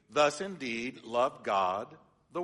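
slow, word-by-word literal reading; stiff literal reading. Shown as a demonstration. Pastor shows the congregation the clumsy literal Greek, slow and stiff. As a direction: read it slowly, each word stiff and separate. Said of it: "so it reads".

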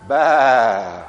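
a loud mock Baa like a sheep; sheep bleat. Congregation confessing they are sheep, loud mock bleat. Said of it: "at the count of three, let's let it be known".

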